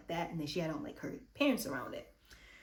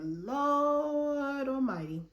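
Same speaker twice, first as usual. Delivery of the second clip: drawn-out exclamation; 7 semitones higher, 4 dB louder, much slower than usual. Real, not acted. Speaker reacts to drama.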